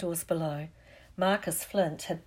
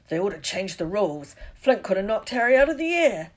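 gruff figure's protest, climbing in pitch; grumble, then burst out louder; outraged big fellow taking sides; indignant Hagrid; 2 semitones higher, 8 dB louder than usual. Acted. Hagrid takes Dean's indignant side.

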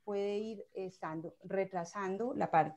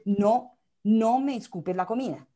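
firm command; 2 semitones higher, 9 dB louder than usual. Shown as a demonstration. Parent firmly stopping a baby's misbehaviour, loud command. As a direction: put your foot down with the child. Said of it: "una voz de mando es una voz firme".

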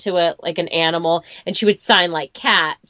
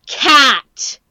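high, loud, drilled "Cat"; stern therapist; 6 semitones higher, 7 dB louder than usual. Retold or imitated. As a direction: drill the word hard. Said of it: "And she would be like".

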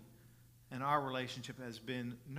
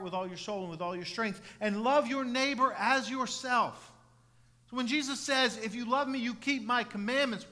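loud, higher preacher's voice; raised sermon voice, punching key words; forceful preaching; 8 semitones higher, 11 dB louder than usual. Real, not acted. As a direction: drive it home to the congregation.

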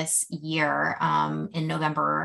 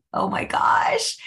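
excited whisper; slower than usual. Real, not acted. Thrilled reaction to own news.

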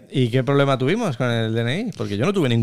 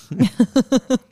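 laugh; 12 semitones higher than usual. Real, not acted. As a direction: burst out laughing at yourself. Laughs at his own boast. (carcajada aguda)

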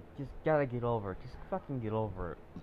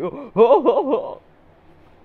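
high loud laugh; 14 semitones higher, 16 dB louder than usual. Real, not acted. Vlogger laughing at his own mockery, loud high laugh.

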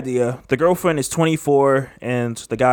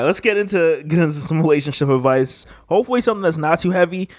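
normal talking voice

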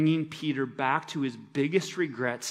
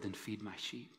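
softer, gentle commission; tender instruction; 3 semitones lower, 12 dB quieter than usual. Retold or imitated. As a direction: quietly send him forward. Jesus gently restoring Peter.

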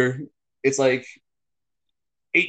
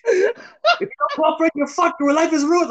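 imitating scammer yelling; 13 semitones higher than usual. Retelling scammers threatening him, high mock yell. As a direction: bark it down the phone like a scammer. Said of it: "they're yelling at me".

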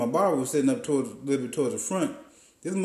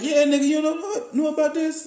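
imitating barber; 13 semitones higher than usual.

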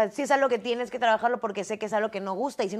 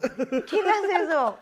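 mock disbelief; 6 semitones higher, much slower than usual. Real, not acted.

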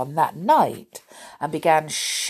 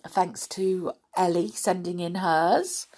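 plain reading voice

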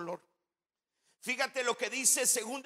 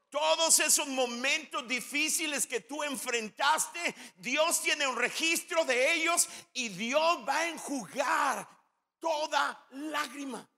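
full-force preaching; 3 semitones higher than usual. Acted. Preacher climaxes the promise.

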